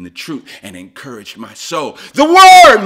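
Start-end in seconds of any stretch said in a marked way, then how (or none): 2.1-2.8 sudden loud high shout of the word; preaching shout. Real, not acted. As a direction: proclaim it like a cry of joy from the pulpit. Preacher exclaims mid-sermon.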